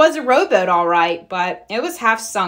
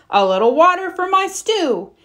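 higher, happy bear voice; delighted with the pump; pleased Papa Bear; 9 semitones higher, 4 dB louder than usual. A narrator's reading.

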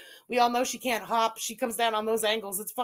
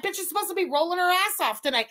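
normal talking voice

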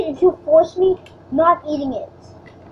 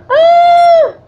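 high loud yell of pain; loud pain scream; 12 semitones higher, 10 dB louder than usual. Real, not acted. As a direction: scream as you get hurt. Hurt in the game.

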